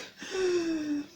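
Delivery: emotional sigh. Retold or imitated. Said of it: "I'm so emotional".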